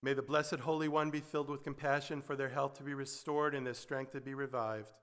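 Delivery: soft, hushed prayer voice, fading to a whisper-quiet amen. Acted. Spoken prayer over soft music.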